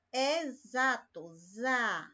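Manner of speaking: "Exato" drawn out with a buzzing Z, then "za" alone; slow "Exato" to show the Z sound; slow syllable. Shown as a demonstration. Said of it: "E não é um Z". ("Exato" devagar, destacando o som de Z)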